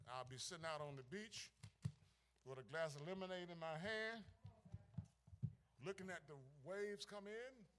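gently voiced mock prayer from a beach chair; hushed musing. Real, not acted. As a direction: muse it quietly, half teasing. Playful daydream, dropped voice.

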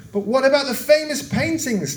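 high-pitched indignant demand; mildly acted outcry, pitched high. A narrator's reading. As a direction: protest as a crowd cheated of its prize. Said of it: "demanded the crowd".

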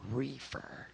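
hushed, secretive whisper of one word. Retold or imitated. Seatmate on a Greyhound bus whispering the word, hushed. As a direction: lean in and whisper it low. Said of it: "but he is whispering".

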